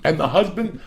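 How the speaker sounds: usual speaking voice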